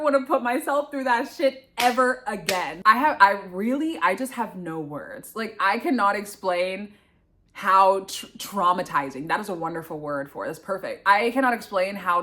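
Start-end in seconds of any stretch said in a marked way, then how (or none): none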